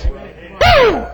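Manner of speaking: high, sudden preacher's whoop; excited whoop. Real, not acted. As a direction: let out a real revival "woo". Preacher whoops at his climax.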